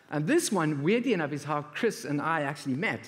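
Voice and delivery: ordinary talk, nothing marked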